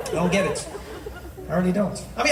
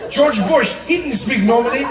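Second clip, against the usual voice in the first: ordinary talk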